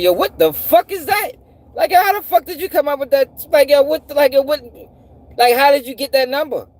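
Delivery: high, baffled outburst; incredulous reaction. Retold or imitated. Man retelling his shock at the number's explanation, incredulous. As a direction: stammer in high-pitched disbelief.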